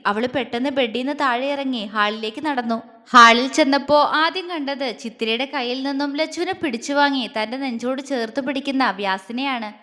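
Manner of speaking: plain reading voice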